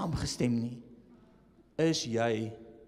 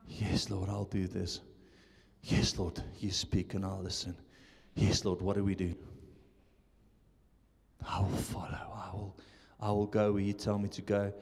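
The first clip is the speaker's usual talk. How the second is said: devoted prayer; 9 semitones lower, 4 dB quieter than usual. Pretend. Voicing an obedient believer's prayer.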